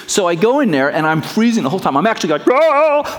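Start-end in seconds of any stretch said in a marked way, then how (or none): 2.5-3.2 sharp high yell from cold water. Retold or imitated. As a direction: yelp at icy water. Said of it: "I'm yelling out".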